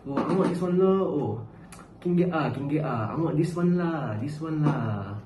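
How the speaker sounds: mock Malaysian street English, sing-song on the particles; casual Malaysian English. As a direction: toss it out as casual Manglish shorthand. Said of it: "shorten it, you know, make it faster".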